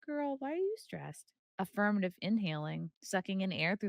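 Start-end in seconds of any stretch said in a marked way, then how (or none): 0.1-1.3 put-on high, soft baby-talk voice to the dog. Asking the stressed dog in a cooing voice. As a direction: coo at the dog like she's a baby. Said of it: "I'm like".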